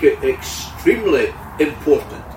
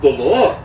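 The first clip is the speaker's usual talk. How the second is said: "the Lord" said louder with a lift; stress on the Lord; 2 semitones lower, 3 dB louder than usual. Shown as a demonstration. Preacher pointing to Acts, stressing the Lord. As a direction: lean on "the Lord". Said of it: "the emphasis seems to be on".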